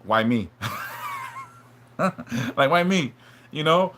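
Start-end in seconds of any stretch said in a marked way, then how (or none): 0.6-1.4 a quick high rueful laugh; short laugh. Real, not acted. Streamer laughing at his own self-pity on stream. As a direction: laugh at yourself mid-confession, pitched well up.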